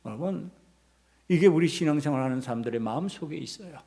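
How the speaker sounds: normal talking voice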